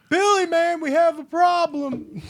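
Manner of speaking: very high, loud, slow squealing alarm; panicked Stu. Retold or imitated. Stu telling Billy that Sidney escaped, high panicked squeal. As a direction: shriek it like it's all gone wrong. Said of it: "He's like".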